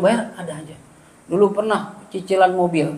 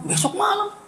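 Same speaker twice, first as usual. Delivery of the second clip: Besok malam jumps far higher as a startled question; shocked echo; 12 semitones higher, 6 dB quieter than usual. Retold or imitated. Preacher hearing he must preach tomorrow night, startled disbelief. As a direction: repeat Besok malam in disbelief, pitch leaping up.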